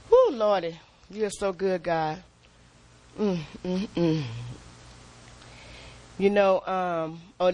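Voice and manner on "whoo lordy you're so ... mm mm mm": soft, low murmured praise to God; softly moaned worship; quiet worship. Real, not acted. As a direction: hum your thanks softly and low.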